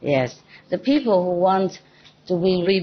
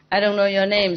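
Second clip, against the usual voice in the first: usual speaking voice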